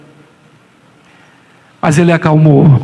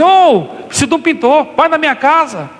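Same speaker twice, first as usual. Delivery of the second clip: high, calling out to a painter; a stranger needing a painter, calling; calling passer-by; 8 semitones higher than usual. Retold or imitated. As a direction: hail the painter from the street. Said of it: "Passa alguém e fala assim".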